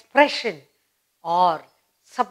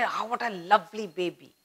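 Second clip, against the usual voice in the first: put-on softly cooing over the baby; a quiet, tender coo at a baby; soft admiration; 8 dB quieter than usual. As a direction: melt at the newborn. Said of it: "everybody is happy about it".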